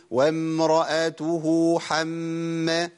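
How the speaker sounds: slow recital of "وامرأته حمّى" with long held sounds; two-count lengthening. Shown as a demonstration. Teacher demonstrating the lengthened vowel, slow chanted "وامرأته". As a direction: chant "وامرأته حمّى" slowly, drawing out the "hu" and the doubled m. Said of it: "همدها حركتين".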